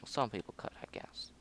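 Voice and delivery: hushed aside under his breath; whispering. Real, not acted. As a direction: whisper it to yourself. Aside muttered to himself.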